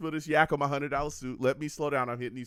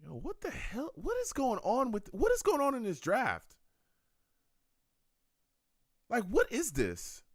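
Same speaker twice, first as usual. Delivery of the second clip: raised, incredulous questions; high-pitched disbelief; 9 semitones higher than usual. Real, not acted. Streamer watching the draft, high baffled disbelief. Reacting to odd draft footage.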